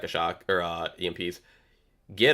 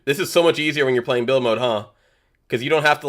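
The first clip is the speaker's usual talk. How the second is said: usual speaking voice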